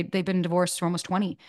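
ordinary talk

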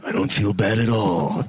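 fast, lightly growled Baldwin; Alec Baldwin impression. Host voices Baldwin shrugging off a killing. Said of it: "spoke as Alec Baldwin".

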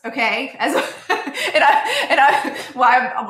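breaking into real laughter mid-sentence; laughing at herself. Real, not acted. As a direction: giggle through the words, pitch jumping up. Laughs at calling herself sorry ass.

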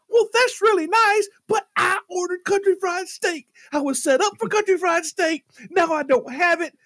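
high-pitched, worked-up protest; acting out an outraged customer; indignant complaint. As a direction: whine and rail at the waiter. Acted complaint to the waiter.

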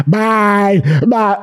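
a playful in-joke voice, lightly done; shared silly voice. Retold or imitated. Friend saying bye on the phone in their silly in-joke voice. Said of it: "we obviously do this voice together".

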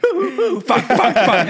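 high hoots into loud swearing; hammed-up tic outburst, high and punchy; imitated whistling tics. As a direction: imitate two people hooting and swearing at each other. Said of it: "They're both whistling at each other".

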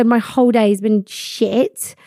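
normal talking voice

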